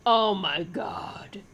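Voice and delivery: slow, stunned oh my god; stunned disbelief, drawn out; gobsmacked. Real, not acted. Shocked again by the cards.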